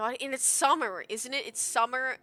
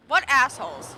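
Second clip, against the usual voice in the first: louder, full swear spoken out; saying the swear loudly; 3 semitones higher, 11 dB louder than usual. Real, not acted. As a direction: say it loud, uncensored. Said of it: "I'll say the word".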